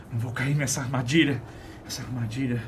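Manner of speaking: normal talking voice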